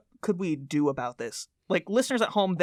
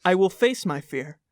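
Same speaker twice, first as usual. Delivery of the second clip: dramatic poster recitation. Acted. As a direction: intone each phrase like a cult creed. Reads poster litany aloud.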